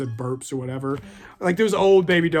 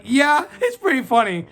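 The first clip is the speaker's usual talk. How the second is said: high, smug and cocky; cheeky reply; 8 semitones higher, 4 dB louder than usual. Retold or imitated. Kid sassing the principal with a smug, high grin. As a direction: toss it back with a grin, mock innocent.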